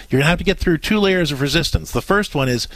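usual speaking voice

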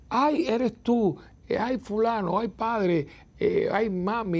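high, slow, delighted "ay, eres tú"; a happy, drawn-out cry of recognition on answering the phone; delighted phone greeting. Retold or imitated. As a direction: greet a loved voice with joy.

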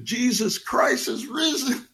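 called out high and slow across the room; loud call. Retold or imitated. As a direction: sing it out to a friend leaving. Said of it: "pero con una voz alta, fuerte". (llamado en voz alta, agudo y lento)

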